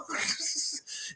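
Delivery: airy high-pitched giggle; high breathless laugh. Real, not acted. Streamer cracking up after the impression, breathy laugh.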